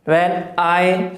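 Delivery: when I, with I leaned on; stress on I. Shown as a demonstration. Teacher demonstrating iambic stress on when I. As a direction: hit the I in when I. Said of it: "आई को stress देके बोलेंगे".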